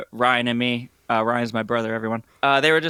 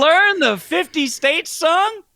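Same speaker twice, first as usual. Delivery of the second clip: incredulous; 13 semitones higher, 5 dB louder, slower than usual. Real, not acted. Incredulous at the girls.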